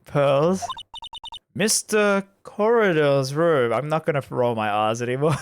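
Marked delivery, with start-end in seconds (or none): none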